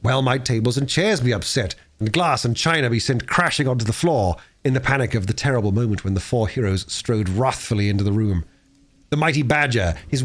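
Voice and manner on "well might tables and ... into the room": plain reading voice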